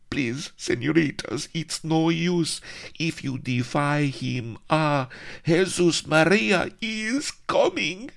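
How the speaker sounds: put-on high fearful Spanish-accented plea. Servant warns ladies.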